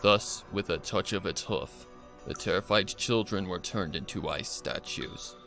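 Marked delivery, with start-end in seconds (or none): none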